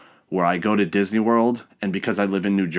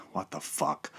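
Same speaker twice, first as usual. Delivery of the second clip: stunned mutter; 4 semitones lower, 12 dB quieter than usual. Retold or imitated. Reacting to the blocked card.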